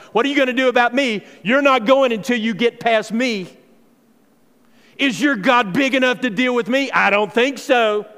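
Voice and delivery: loud, high, fast jeering; a giant loudly mocking the believers; mocking giant's taunt. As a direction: sneer it loud like a bully.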